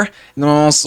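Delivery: ordinary talk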